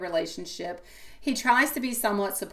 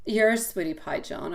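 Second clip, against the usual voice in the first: normal talking voice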